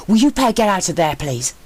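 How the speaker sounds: an exasperated leader yelling down. A narrator's reading. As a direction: yell at them to come up. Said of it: "He yelled".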